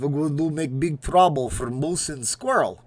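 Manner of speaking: cartoon villain in a put-on Russian accent; Boris Badenov. Cartoon villain plotting against moose and squirrel, Russian accent. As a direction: scheme aloud in a hammy Russian accent. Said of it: "Boris Badenov".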